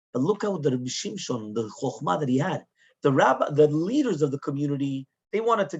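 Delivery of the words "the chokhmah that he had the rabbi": ordinary delivery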